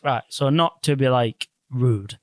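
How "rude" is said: ordinary talk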